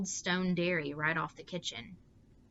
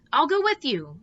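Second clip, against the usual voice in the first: high and loud, voice gone shrill; 9 semitones higher, 10 dB louder than usual. A narrator's reading. Meg insists on going with her mother, shrill and loud. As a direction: jump up high with fright. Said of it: "Meg's voice was shrill".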